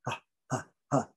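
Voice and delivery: just let air out on each ha; barely there breathy ha sounds; soft aspirate demo. Shown as a demonstration. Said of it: "It's just letting out air".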